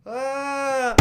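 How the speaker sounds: high, hammed-up wrestler's shriek; a sudden strained yelp as if falling; mock ring cry for the podcast host; Owen Hart yell. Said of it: "this is Owen Hart".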